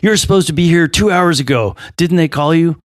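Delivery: a mock hurried reproach; urgent scolding. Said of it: "He goes".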